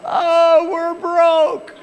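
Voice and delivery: high, loud panicked wail; mock child crying in panic. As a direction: shout it like a frightened little girl. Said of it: "she started crying out".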